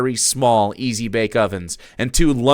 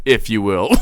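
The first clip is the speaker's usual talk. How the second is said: normal talking voice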